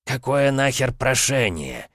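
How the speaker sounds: возмущение. A narrator's reading. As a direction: draw it out in affronted disbelief. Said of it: "возмутился Камара". (возмущённо, нараспев)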